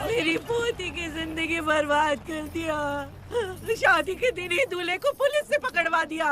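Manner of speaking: grandmother's wailing lament; wailing outcry. Acted. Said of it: "अरे, ऐसे क्यों चिल्ला रही हो?".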